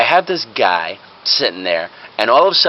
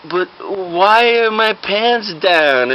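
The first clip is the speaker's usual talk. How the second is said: higher, drawn-out puzzled question; bewildered patient; 6 semitones higher, much slower than usual. Acted. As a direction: ask slowly in acted confusion.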